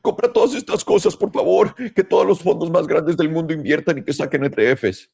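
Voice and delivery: sarcastic parody of crypto fans pleading with Trump; mock whiny begging. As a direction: beg like a crybaby for a handout. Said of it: "todos llorando que".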